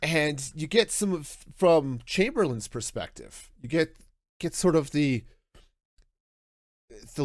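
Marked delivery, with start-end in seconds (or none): none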